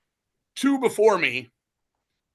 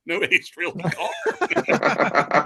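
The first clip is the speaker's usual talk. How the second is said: laughing; 3 semitones higher, 4 dB louder, much slower than usual. Real, not acted. Laughing at missing Ace merch.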